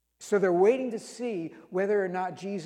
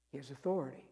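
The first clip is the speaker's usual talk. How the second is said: "He has authority" said softly and lower; quiet refrain; 4 semitones lower, 7 dB quieter, much faster than usual. Shown as a demonstration. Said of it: "repeated refrain, said softly".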